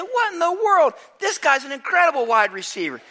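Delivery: high, astonished exclamation; amazed coach. Retold or imitated. Coach amazed at his fast player's catches, high astonished voice. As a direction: sound blown away by what you saw. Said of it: "I said".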